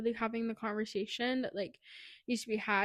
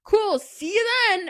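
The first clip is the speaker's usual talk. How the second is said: high, loud, slow fake cheer; forced casual; 10 semitones higher, 12 dB louder, slower than usual. Kieran hiding his jealousy from Ash, falsely cheery. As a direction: mask the jealousy with fake breeziness. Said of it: "in his head, he goes".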